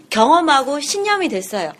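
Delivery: ordinary delivery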